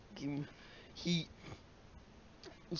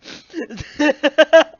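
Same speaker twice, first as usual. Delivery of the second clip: loud laughter burst; 8 semitones higher, 12 dB louder than usual. Real, not acted.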